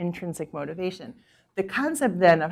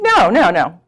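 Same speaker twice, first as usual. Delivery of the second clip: assertive refusal; 9 dB louder than usual. Retold or imitated. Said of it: "That's a pretty assertive woman".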